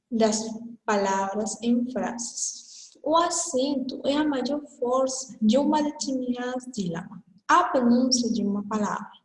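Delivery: ordinary delivery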